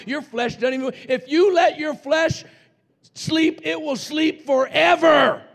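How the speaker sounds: loud, high preaching climbing to a shout; preaching at full force. Acted. Preacher warning the church about the flesh, building to a shout. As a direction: thunder it at the congregation.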